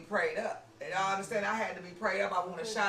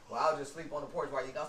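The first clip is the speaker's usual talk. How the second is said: sulky brother; 8 semitones lower, 3 dB quieter, faster than usual. Retold or imitated. Brother voiced in retold argument.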